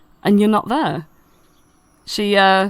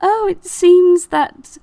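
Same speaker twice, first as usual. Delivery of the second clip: very high, drawn-out flower voice; high, louder vain flower character; coy Gloria; 10 semitones higher, 4 dB louder than usual. Acted. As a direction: announce an arrival in a lifted, lilting voice. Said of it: "and she says".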